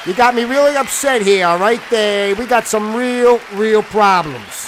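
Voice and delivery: a wound-up local boss airing grievances; upset Vincenzo. Acted. Host plays guest Vincenzo.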